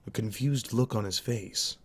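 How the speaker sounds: ordinary delivery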